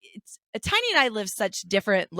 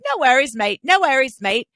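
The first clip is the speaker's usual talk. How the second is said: loud, high put-on Australian accent; mock Australian accent; 5 semitones higher, 7 dB louder than usual. Back from Australia, mocking herself in a loud Australian accent. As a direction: ham up an Australian no worries mate. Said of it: "people were like".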